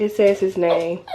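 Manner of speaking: ordinary talk, nothing marked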